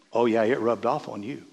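low, soft, knowing aside; acting a quietly knowing onlooker; knowing accusation. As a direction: drop low and quiet, as if you see through them. Crowd's knowing reply to Peter, acted.

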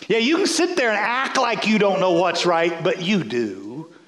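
normal talking voice

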